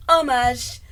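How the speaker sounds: "Homage" loudly as ah-MAHZH; French homage. Shown as a demonstration. Celebrating the guess, "Homage" with French zh. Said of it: "I knew it was French".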